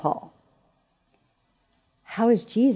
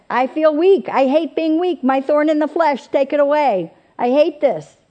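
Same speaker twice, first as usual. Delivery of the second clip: pained plea; 7 semitones higher, 5 dB louder than usual. Acted. Paul voiced pleading in his weakness.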